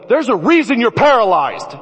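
high, incredulous comeback; alarmed protest. Retold or imitated. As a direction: refuse in comic disbelief. Protests uncle's order to unhook shark.

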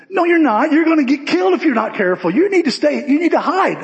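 panicked friend pleading with David to hide; alarmed warning. Acted. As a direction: beg him to hide before he's killed. Tim warning David of danger.

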